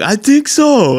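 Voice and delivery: high put-on streamer voice. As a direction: pitch it up as a caricature. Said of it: "And he's like".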